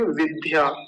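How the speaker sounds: विद्या with the d breathed as ध; mispronunciation demo. Shown as a demonstration. Teacher quoting a common mistake, विद्या with aspirated dh. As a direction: put a puff of air into the d of विद्या. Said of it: "ये गलत उच्चारण है".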